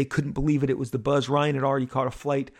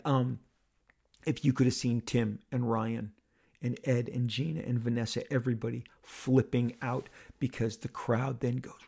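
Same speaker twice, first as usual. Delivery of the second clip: ordinary talk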